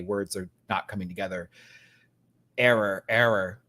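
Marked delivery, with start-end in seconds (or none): 2.6-3.6 mock robot error. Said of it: "that's not what I meant to say".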